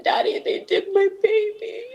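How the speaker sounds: reliving the call, voice cracking; tearful plea. Retold or imitated. Quoting herself to her father in tears.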